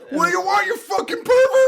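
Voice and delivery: put-on girl yelling at Michael Myers in parody; mock screaming girl. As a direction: screech at the stranger. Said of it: "she's like".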